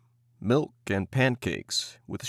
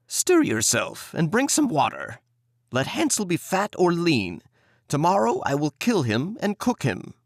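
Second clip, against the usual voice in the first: higher, commanding witch voice; impatient witch; 6 semitones higher than usual. Acted. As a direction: bark out the threat. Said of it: "she cried to the girl".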